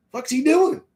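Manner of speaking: stunned disbelief at a friend; baffled. Retold or imitated. Staring at Dwayne arched over the bowl, baffled.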